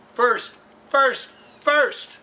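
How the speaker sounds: high, loud repeated insistence; emphatic shout. Real, not acted. Host insisting viewers make the cap first, shouting.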